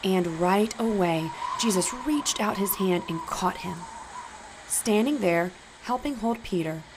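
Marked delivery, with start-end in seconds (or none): none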